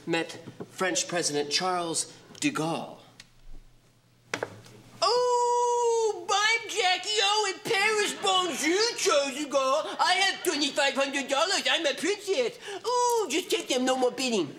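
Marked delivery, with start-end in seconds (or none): 5.0-14.6 very high, giddy princess voice; pretend Jackie O. Bidder pretends to be Jackie O in Paris, high and giddy.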